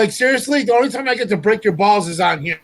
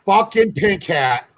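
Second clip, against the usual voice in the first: stretched out slowly; slow drawn out; much slower than usual. Acted. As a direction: drag each word out slowly. Repeating the insult twice.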